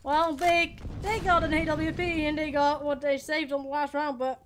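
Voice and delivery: brisk esports caster calling the round; live play-by-play. Acted. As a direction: call the action as it happens. Casting the round.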